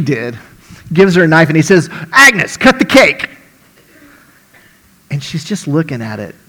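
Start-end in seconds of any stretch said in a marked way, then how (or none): 2.1-3.3 high, loud bellow of a gruff cook; a boisterous diner owner hollering; excited shout. Acted. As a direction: yell it across the diner, pushing her on. Owner urges Agnes again.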